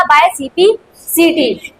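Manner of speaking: dictated very slowly, word by word; teacher dictating the answer slowly; slow dictation. Shown as a demonstration. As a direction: spell it out so the class can copy it. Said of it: "हमें क्या लिखना पड़ेगा?".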